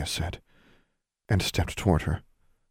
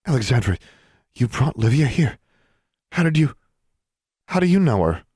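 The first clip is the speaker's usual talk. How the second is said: higher-pitched, distressed mother; rising into alarmed disbelief; stricken; 8 semitones higher, 4 dB louder than usual. A narrator's reading. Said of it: "Stricken, Alexandra's mother said".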